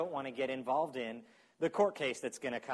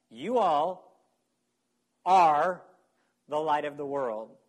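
"are" hit much louder, slow and spaced out; emphatic "You all are"; 7 dB louder, slower than usual. Shown as a demonstration. Preacher telling the congregation that all of them are the light, emphatic. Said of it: "He's using the plural, emphatic usage".

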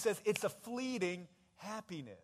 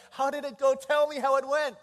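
loud, raised, excited asking; eager questioning; 5 semitones higher, 9 dB louder than usual. Acted. Jesus asking returning disciples for their report, loud and eager. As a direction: press them keenly for their news.

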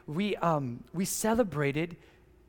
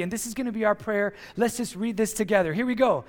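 normal talking voice

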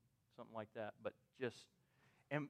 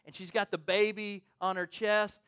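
usual speaking voice

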